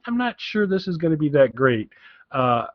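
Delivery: ordinary delivery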